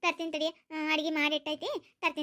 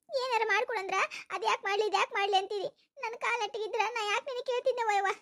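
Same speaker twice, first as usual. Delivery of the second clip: normal talking voice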